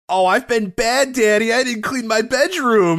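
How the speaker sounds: put-on high, slow, whiny childlike voice; mock little girl voice. Mocking a roleplay tweet in a high little-girl voice. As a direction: play a bratty little girl confessing to Daddy. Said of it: "roleplaying Alexa as a child".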